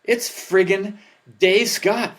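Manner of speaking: rising into a high, loud, thrilled gush; starstruck excitement. Retold or imitated. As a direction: squeal it like a fan who can't believe his luck. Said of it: "I'm like".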